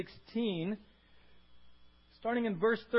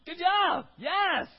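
very high, loud whoop of joy; cheering Jesus; 14 semitones higher, 18 dB louder, much slower than usual. Pretend. Jesus cheering Peter's answer, loud joyful whoop. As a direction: cheer like your friend just won. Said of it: "And Jesus goes".

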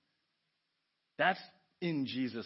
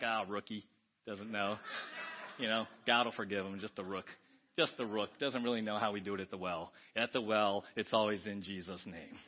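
lower, softer smug church regular; smug insider; 7 semitones lower, 3 dB quieter than usual. Retold or imitated. Churchgoer judging a newcomer, low and smug. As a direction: mutter like a know-it-all church insider.

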